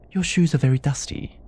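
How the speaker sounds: put-on high-pitched little child figure; light, high voice of a moon child; child's voice. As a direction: lift the voice high for a small child. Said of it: "face of a child".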